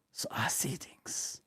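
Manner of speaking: eerie stage whisper. Pretend. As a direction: breathe it like a ghost story. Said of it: "spooky whisper joke".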